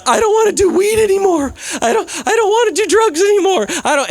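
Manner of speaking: high, sobbing voice close to tears; tearful sobbing plea. Retold or imitated. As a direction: plead through tears, voice breaking high. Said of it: "she's tearing up".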